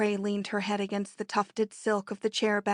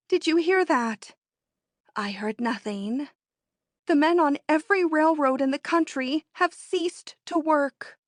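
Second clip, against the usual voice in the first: high, louder urgent news; excited; 6 semitones higher, 3 dB louder than usual. Acted. Natalie telling her friend the strike news, excited. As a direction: announce it breathless with excitement.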